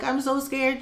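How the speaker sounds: high, small scared voice; retold fear. Patient telling the nurse she is scared, fearful. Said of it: "I'm just like".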